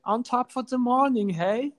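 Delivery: cheerful mock Irish hello; mock Irish greeting. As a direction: tease with a stage-Irish greeting. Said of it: "the Irish customers come and say".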